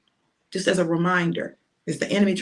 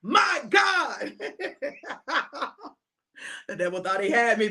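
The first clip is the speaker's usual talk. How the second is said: usual speaking voice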